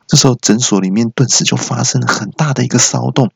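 ordinary talk

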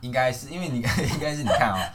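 can't hold back a laugh while answering. Real, not acted. Host answering the guest's left-hand-drive joke, laughing.

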